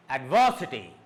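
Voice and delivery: adversity with VER punched, then dropping low; stress on VER. Shown as a demonstration. Teacher applying the up-then-down rule, stress on VER. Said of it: "raise is stressed".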